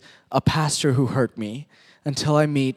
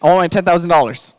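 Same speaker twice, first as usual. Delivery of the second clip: demanding; 6 dB louder than usual. Acted. Elsie demands her money.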